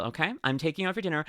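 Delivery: normal talking voice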